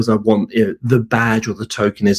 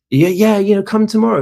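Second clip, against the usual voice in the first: much higher, breezy manager; a lightly voiced, upbeat manager; manager's voice; 10 semitones higher than usual. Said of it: "And he goes".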